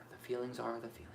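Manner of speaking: breathy whisper, letting it out. Real, not acted. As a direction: whisper it to yourself. Quiet emotional aside.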